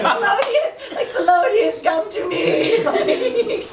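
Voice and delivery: high, slow, laughing ghostly call; beckoning ghost. Retold or imitated. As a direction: lure Polonius over like a spooky friend. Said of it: "they could be, like, ghost friends".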